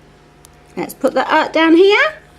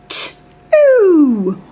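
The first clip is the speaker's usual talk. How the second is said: ordinary talk, nothing marked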